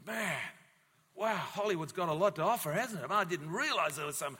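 excited, rising high and loud; mock wowed viewer. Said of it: "And we go".

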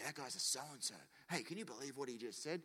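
hushed mock gossip; gossipy aside. Friend gossiping once someone leaves, hushed aside. Acting out gossip behind a back.

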